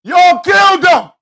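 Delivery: high, loud, slow furious yell at them; furious shout. Real, not acted. Ranting at the killers' camp with a furious shout. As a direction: bellow the accusation.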